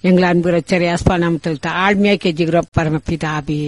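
earnest pleading prayer, steady and intense; fervent prayer. Acted. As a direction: pray over the people, begging for healing. Leads a long fervent prayer. (உருக்கமான ஜெபம்)

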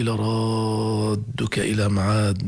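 "لرادك" with a long held aa on one level pitch; obligatory long madd. Shown as a demonstration. Teacher demonstrates madd lazim, very long vowel in "لرادك". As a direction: stretch the aa of "لرادك" far out, then double d. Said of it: "بنسميه مد لازم".

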